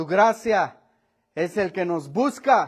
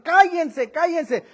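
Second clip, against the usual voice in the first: hushing shout; 8 semitones higher, 3 dB louder, slower than usual. Retold or imitated. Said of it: "Los callaban a él".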